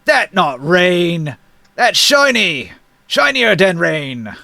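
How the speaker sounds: slow, drawn-out giant argument. Acted. Second giant arguing with the first, slow giant voice. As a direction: correct a fellow giant, stretching the words. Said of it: "And the other giant says".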